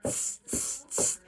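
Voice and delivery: soft voiceless "tss" sounds; monkey chatter. A narrator's reading. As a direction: imitate monkeys with little tongue-and-teeth noises. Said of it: "and they said".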